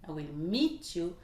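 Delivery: MEET stands out, "I will" and "you" kept light; stress on MEET. Shown as a demonstration. Teacher points out the stressed verb, stress on MEET. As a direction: lean on MEET. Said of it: "C'est le verbe to meet".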